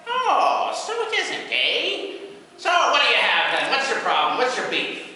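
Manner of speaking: high, loud, bristling gatekeeper; Oz doorkeeper. Acted. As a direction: pick a fight through the peephole. Doorman challenges Joseph.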